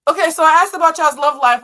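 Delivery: high, loud explaining; incredulous outburst. Real, not acted. Reader asked about love, got money card, incredulous outburst. Mock protest over the card drawn.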